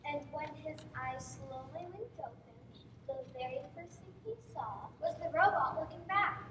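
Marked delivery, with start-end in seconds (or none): none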